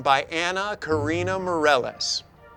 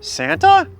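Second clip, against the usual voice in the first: much higher startled question; surprised; 8 dB louder than usual. Acted. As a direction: gasp out the name. Spotting Santa.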